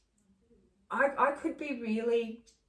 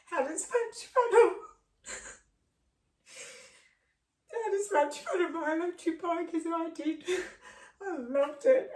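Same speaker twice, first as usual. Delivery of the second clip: high, tearful voice breaking; crying through the words about the bike; real tears, voice cracking high and loud; 8 semitones higher, 4 dB louder than usual. Real, not acted. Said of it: "excuse me, guys. Sorry, sorry".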